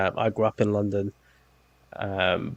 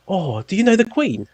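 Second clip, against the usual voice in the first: high mock-gushing American question; lightly voiced eager American; imitating an American fan; 9 semitones higher than usual. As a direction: play the starstruck local, pitched up. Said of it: "people just hear me speak, and it's like".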